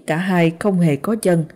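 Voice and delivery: usual speaking voice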